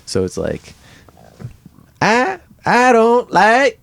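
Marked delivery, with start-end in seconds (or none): 2.0-3.8 high, drawn-out halting voice; botched character voice. Acted. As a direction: stretch it out slowly, pitched way up. Botched accent attempt for a laugh.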